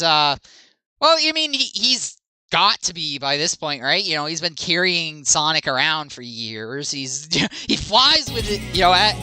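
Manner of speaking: ordinary talk, nothing marked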